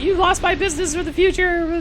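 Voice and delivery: high, whiny mock customer voice; mock irate customer. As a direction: squeak it out like an offended shopper. Angry customer quoted in retail story.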